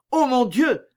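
high, alarmed exclamation; sudden cry. A narrator's reading. Montalais hears someone coming, sudden startled cry. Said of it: "s'écria tout à coup Montalais".

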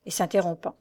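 ordinary delivery, nothing marked

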